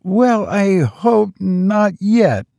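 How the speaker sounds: put-on high, slow, hesitant old servant; timid old servant. A narrator's reading. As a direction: venture it timidly. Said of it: "ventured the old servant".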